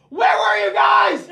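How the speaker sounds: put-on high, slow yelling of a frantic teen out a car window; retelling his son's frantic yell from the car; panicked shout. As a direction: call out high and drawn out, half panicked.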